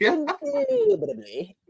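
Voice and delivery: high, slow, sneering villain drawl of "Indubitably."; Disney villain voice. Pretend. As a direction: purr it like a mustache-twirling cartoon villain. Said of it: "you need, like, the little curly mustache when you say".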